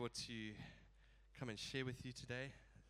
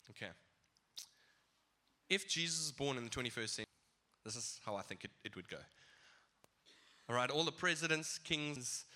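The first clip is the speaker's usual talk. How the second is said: louder, building preacher's delivery; preaching; 5 dB louder than usual. Acted. Preacher paints a modern nativity for the congregation, building in force. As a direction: drive the picture home to the church.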